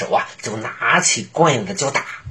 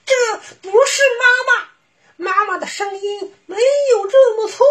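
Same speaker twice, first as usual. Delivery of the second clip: put-on very high, urgent child voice; a bunny sounding the alarm; little bunny voice. Bunny warns sisters.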